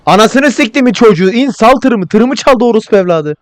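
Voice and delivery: loud, high outraged cursing; angry shouting. Pretend. Player cursing whoever stole his truck, angry shouting. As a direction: yell furiously after the thief.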